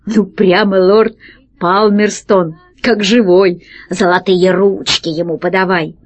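ordinary delivery, nothing marked

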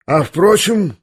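high, sudden start; startled. A narrator's reading. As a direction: come to with a jolt. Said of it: "вздрогнул он вдруг".

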